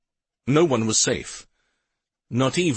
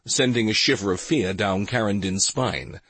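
ordinary delivery, nothing marked

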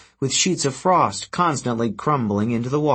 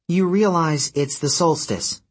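put-on girl voice; 6 semitones higher than usual. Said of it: "the girl said".

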